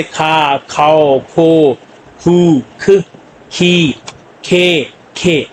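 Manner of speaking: "खा, खाओ, खो" with a strong puff after each ख; aspirated sound. Shown as a demonstration. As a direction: drill ख syllables slowly, one by one, aspirated. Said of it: "aspirated के साथ". ("खा, खाओ, खो" में ख महाप्राण, धीरे-धीरे)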